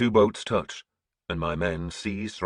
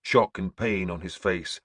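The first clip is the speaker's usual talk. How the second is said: plain reading voice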